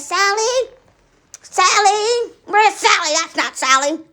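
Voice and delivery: loud, high anxious calling. Retold or imitated. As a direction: call out for Sally, worried. Calling for missing Sally.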